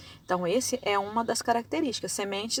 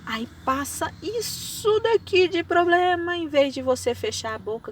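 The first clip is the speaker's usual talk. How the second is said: loud, driving sermon build; a loud preacher's build; preaching at full force; 6 semitones higher, 10 dB louder than usual. Acted. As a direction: hammer the point home to the congregation.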